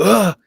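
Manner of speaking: sudden high, loud startled grunt; startled yell. Retold or imitated. Man jumping back from a creature, loud startled yell. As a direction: jolt out a scared yell. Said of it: "he yelled".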